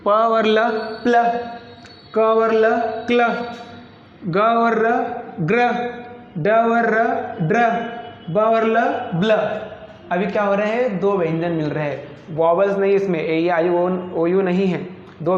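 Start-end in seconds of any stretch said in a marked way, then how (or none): 0.1-9.4 two sounds, then fused as प्ल, क्ल, ग्र, ड्र, ब्ल; teacher sounding out ग्र and ड्र, parts first, then blended; consonant blend demo. Shown as a demonstration. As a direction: join the consonants into one short syllable, like प्ल. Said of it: "Hindi में कैसा होता है देखिए".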